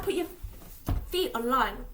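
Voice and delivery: usual speaking voice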